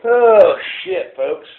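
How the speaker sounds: sudden high dismayed yelp; dismayed cry. Real, not acted. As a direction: cry out as the part fails. Part breaks on the lantern.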